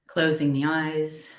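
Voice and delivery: unhurried yoga nidra guidance, long pauses between phrases; calm, measured meditation voice, each phrase settling downward; guided meditation. Real, not acted.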